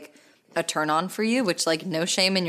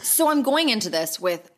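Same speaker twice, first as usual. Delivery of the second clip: ordinary talk, nothing marked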